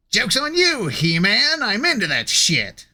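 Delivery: high sneering Skeletor villain; slow theatrical villain menace. Pretend. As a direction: taunt He-Man like a cartoon villain. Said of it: "The true classic villain voice".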